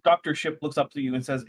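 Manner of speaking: ordinary talk, nothing marked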